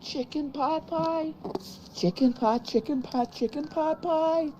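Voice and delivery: very high, slow silly voice; David Cross impression. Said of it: "David Cross".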